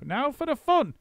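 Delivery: high excited ringmaster monkey; gleeful showman. A narrator's reading. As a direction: whip up the crowd for the big trick. Mugglewump's announcement.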